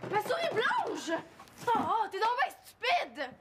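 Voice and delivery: high, drawn-out cries of outrage; upset outburst. Acted. Owner blames someone for the escaped mouse, upset outburst. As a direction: wail the accusation, pitch swooping up.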